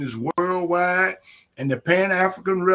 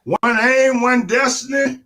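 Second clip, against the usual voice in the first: loud, high rally cry; shouted slogan; 7 semitones higher, 4 dB louder than usual. Real, not acted. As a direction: belt out the slogan.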